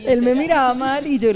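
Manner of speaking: laughing. Real, not acted. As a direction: laugh while telling it. Ríe recordando al pastor. (contando entre risas y más fuerte)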